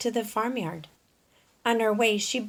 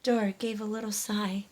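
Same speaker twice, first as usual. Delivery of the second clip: usual speaking voice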